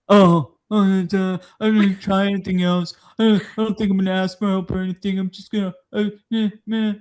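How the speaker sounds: whiny, half-hearted dude voice; mock lazy partner. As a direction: mumble like a quitter. Mocking partner giving up.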